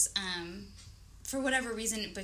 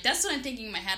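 ordinary talk, nothing marked

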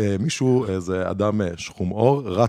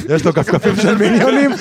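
high, laughing delight; laughing about the minion slippers, higher; 8 semitones higher, 4 dB louder than usual. Real, not acted. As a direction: crack up as you say it.

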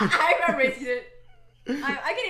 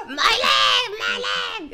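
high, loud cartoon cry; SpongeBob character; 9 semitones higher, 9 dB louder, much slower than usual. Friend guessing a SpongeBob impression, high cartoon yell. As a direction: shout it like a cartoon bystander in pain. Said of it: "impression of that one guy from SpongeBob".

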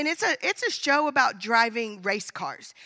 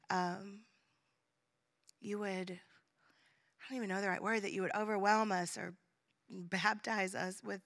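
soft, hushed prayer voice; quiet prayer; 4 semitones lower, 8 dB quieter than usual. Real, not acted. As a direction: pray gently, almost under breath. Pastor leads a quiet prayer.

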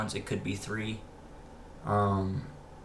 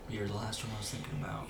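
whisper; 11 dB quieter than usual. Pretend. Whispered quote to special girl.

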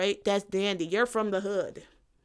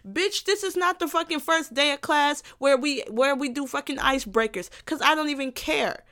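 ordinary talk, nothing marked

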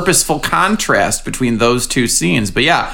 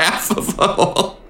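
breathless, wheezing with laughter; laughing whisper; 5 semitones higher, 6 dB quieter than usual. Real, not acted. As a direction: gasp it out mid-laugh. Laughing at the disc golf story.

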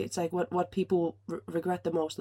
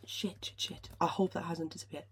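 whispered swearing under the breath; panicked whisper; 6 dB quieter than usual. Real, not acted. Host whispers after her computer crashes, panicked whisper. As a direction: hiss it to yourself so no one hears.

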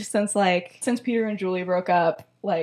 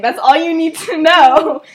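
loud, raised, emphatic brush-off; mock brusque shutdown; 5 semitones higher, 10 dB louder than usual. He refuses to tell her his research, mock brusque. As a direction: shut the question down with playful force.

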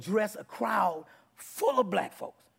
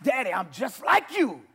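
high, loud joyful cry; joyful declaration; 7 semitones higher, 5 dB louder than usual. Retold or imitated. Child declaring to Daddy God, high loud joy. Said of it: "you go".

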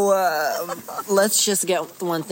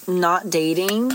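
ordinary talk, nothing marked

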